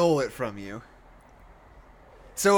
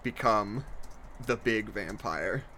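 low, slow and soft; a grave, hushed storybook finale; solemn narration; 3 semitones lower, 4 dB quieter, slower than usual. A narrator's reading. Solemn echo of Alice's line.

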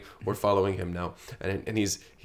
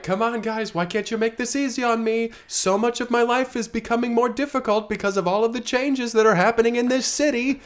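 high whiny plea; Carl voice; 12 semitones higher, 3 dB louder than usual. Acted. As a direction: whine at them, pitched way up. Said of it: "Carl's like".

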